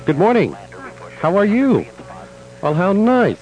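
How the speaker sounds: bright, high welcome; delighted greeting. Real, not acted. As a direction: greet unexpected guests warmly. Greeting surprise visitors.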